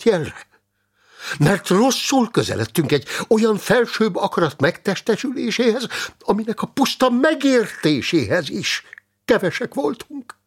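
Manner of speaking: high, impassioned argument; agitated, high-voiced zealot; fervent monk. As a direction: press the questions urgently, pitched well up. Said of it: "A bencés szerzetes zavartalanul folytatta".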